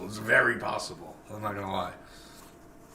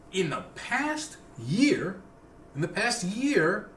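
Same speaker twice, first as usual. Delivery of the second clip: slow dramatic; 8 semitones higher, much slower than usual. Real, not acted.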